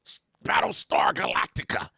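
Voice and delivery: whispered mockingly; hushed teasing whisper; mock whisper. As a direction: breathe the name like a secret. Mocking a list of gamer names.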